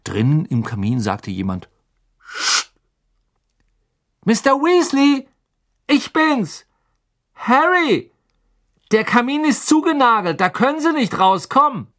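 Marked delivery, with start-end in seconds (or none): 2.2-2.7 unvoiced shushing; sharp hush. Someone inside the fireplace shushing the rest. 4.2-11.9 high, loud call; calling through boards. A narrator's reading. Harry calls through the boarded fireplace, loudly. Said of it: "rief durch die Bretter".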